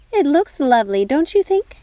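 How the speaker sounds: a busy fairy godmother fussing over tea; fussy fairy godmother. Acted. Fairy godmother admires decor.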